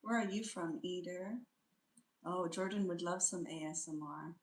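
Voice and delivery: ordinary talk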